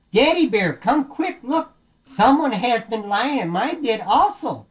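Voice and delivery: high, urgent mama bear call; alarmed Mama Bear. Acted. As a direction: call for help in a high story voice. Mama Bear calls about her bed.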